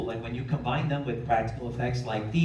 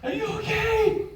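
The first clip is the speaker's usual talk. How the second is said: high, loud frantic call; 4 dB louder than usual. Acted. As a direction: call out to her in mock panic. Frantic calling to victim.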